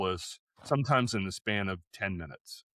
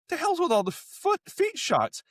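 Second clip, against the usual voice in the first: mock incredulous wife, pitched way up; wife's outburst. Wife reacting to the endless foot shots, mock incredulous high. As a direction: play his wife's baffled complaint, high. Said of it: "She goes".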